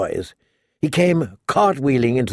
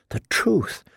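softer, higher, hesitant; timid reluctance; 6 semitones higher, 5 dB quieter, slower than usual. Acted. Sansa asked for the truth about Joffrey, timid and hushed. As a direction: shrink from answering, hushed. Sansa cornered by dangerous question.

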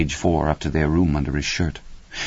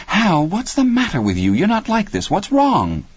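much higher, pleading wife's voice; distressed concern. A narrator's reading. Wife asks husband what is wrong, anxious and high. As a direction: voice climbing with worry.

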